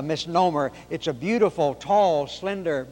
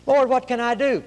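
higher, louder plea to the Lord; pleading question; 9 semitones higher, 5 dB louder than usual. Acted. Believer asking the Lord what to do, pleading. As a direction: call out an earnest question upward.

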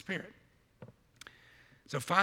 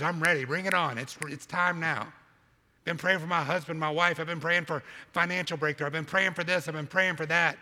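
loud, raised, hammed-up insistence; impatient demand; 4 semitones higher, 6 dB louder than usual. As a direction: press God impatiently, punching each plea. Acting out impatient believer's prayer.

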